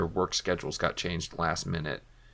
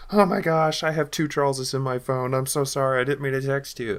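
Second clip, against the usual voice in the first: high-pitched, gushing mock apology; imitated flustered apology; 6 semitones higher than usual. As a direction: act out a mortified texter, voice pitched up. Retells texter's embarrassed apology for mistake.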